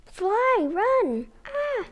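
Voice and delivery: panicked squeak. Acted. Animals fleeing fox.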